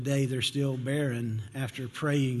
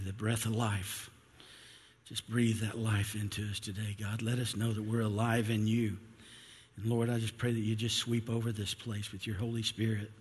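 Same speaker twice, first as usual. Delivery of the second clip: earnest, softening spoken prayer; 2 semitones lower than usual. Acted. Pastor leads opening prayer.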